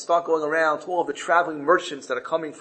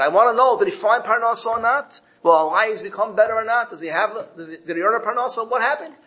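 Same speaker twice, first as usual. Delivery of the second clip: pitched-up, insistent questioning; anxious urgency; 6 semitones higher, 4 dB louder than usual. Acted. Poor man's wife desperate to know if he earned a living, urgent. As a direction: press urgently for answers, voice rising.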